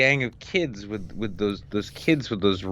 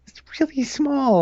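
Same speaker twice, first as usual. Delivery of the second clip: whispering. Pretend. Joking aside about Ben Shapiro.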